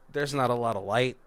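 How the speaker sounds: ordinary talk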